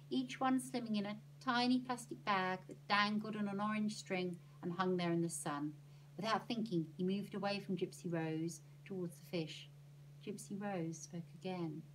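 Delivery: ordinary delivery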